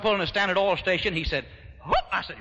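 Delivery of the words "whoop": short imitated holler bursting out; quick hiccup of a hoot; a whoop he can't hold in; involuntary whoop. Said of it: "if you holler".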